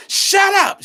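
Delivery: high, loud mock yell; getting all loud. Girl answering a whispered plea, suddenly loud and high. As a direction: blast a girl's dismissal at high pitch. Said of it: "She trying to get all loud".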